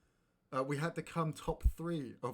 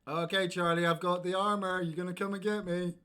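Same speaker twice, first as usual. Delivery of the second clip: loud, raised-pitch call to a teammate; excited shout to a gaming partner; 7 semitones higher, 8 dB louder than usual. Real, not acted. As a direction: summon your partner mid-game, high and loud. Calling to teammate in game.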